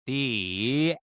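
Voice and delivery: slow booming sign-off, drawn way out; dramatic announcement. Acted. Storyteller closing the chapter with a slow booming flourish. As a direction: boom out the ending like a trailer voice.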